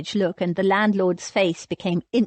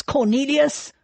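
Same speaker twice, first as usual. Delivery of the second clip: high-pitched holler; angry calling out; 7 semitones higher, 3 dB louder than usual. A narrator's reading. Thomas hunting Cornelius through the house, calling out angrily. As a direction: call the name out hard and high. Enraged man calling for his cousin.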